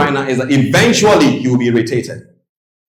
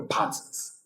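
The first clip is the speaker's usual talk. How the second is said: a softer whispered echo; 15 dB quieter than usual. Real, not acted. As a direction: breathe it out softly. Word repeated as whisper.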